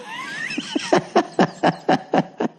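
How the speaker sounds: high, far-off cackling laugh; shrieking laugh. Real, not acted. Comedian cracking up at the attendant, high laugh. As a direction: burst into a high-pitched cackle. Laughing at the Paul Burr mixup.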